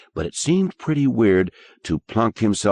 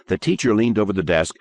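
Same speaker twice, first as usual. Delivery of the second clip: ordinary delivery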